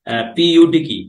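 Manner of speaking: ordinary talk, nothing marked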